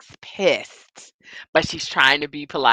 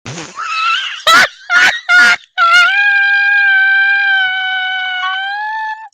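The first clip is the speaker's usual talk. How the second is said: very high, loud, long cackle; helpless squealing laugh; shrieking laughter; 11 dB louder than usual. Real, not acted. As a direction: laugh till it turns into a shriek. Cracking up at the scene.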